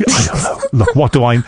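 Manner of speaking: ordinary delivery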